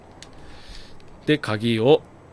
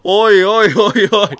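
real shock, drawn out and louder; a long stunned "oh no no"; higher, slower disbelieving protest; startled; 7 semitones higher, 9 dB louder, slower than usual. Real, not acted. Map floor suddenly collapses.

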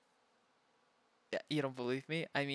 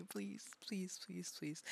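soft pleading; 3 semitones higher, 10 dB quieter, slower than usual. Real, not acted. Pleading while tickled.

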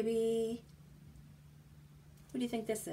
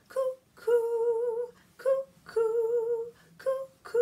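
high loud cuckoo-bird call; 14 semitones higher, 6 dB louder, slower than usual. Acted. Cuckoo clock call in rhyme.

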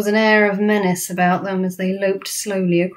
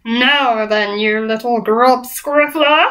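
loud, higher, slow bully giant; drawn-out bullying boom; 4 semitones higher, 4 dB louder, slower than usual. A narrator's reading. Flesh Lump Eater confronting BFG, booming. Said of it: "boomed the flesh lump eater".

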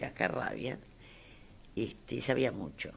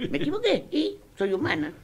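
defiant retort; 8 semitones higher, 7 dB louder than usual. Retold or imitated.

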